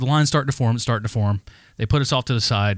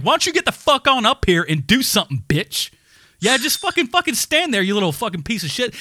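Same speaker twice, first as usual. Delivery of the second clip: retold taunting yell; 8 semitones higher than usual. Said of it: "My brother was like".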